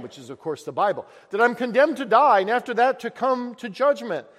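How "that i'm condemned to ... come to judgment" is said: higher, louder anguished plea; distressed pilgrim. Acted. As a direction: cry it out as a condemned man. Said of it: "meets him when he's crying".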